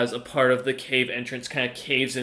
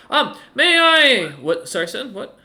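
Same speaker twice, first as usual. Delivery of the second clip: much higher, louder, forgetful dwarf, searching for his own name; a cheery, absent-minded old mage who loses his thread; scatterbrained old dwarf wizard; 10 semitones higher, 7 dB louder than usual. Acted.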